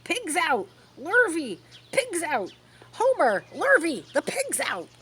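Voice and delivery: shouting. Acted. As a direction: raise the alarm to the men. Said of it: "she immediately shouted for the men".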